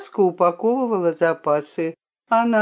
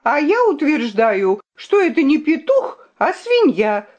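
high-pitched, insistent accusation; indignant cry; 7 semitones higher, 3 dB louder than usual. A narrator's reading. The princess declares the rooster a pig, crying out. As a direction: call it out indignantly. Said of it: "крикнула королевна".

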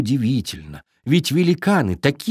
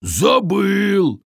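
shouted; 7 semitones higher than usual. A narrator's reading. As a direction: yell it bluntly. Giant bark.

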